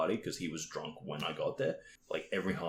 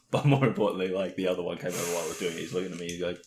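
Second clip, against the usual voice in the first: usual speaking voice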